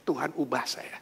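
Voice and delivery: soft, whispered plea to God; wife whispering a prayer to God. Acted. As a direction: pray it under your breath.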